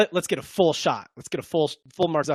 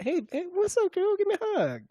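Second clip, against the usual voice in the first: mock flirting in a much higher voice; Derwin James; 13 semitones higher, 4 dB quieter than usual. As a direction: put on a high-pitched pick-up voice. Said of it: "laying some game on her".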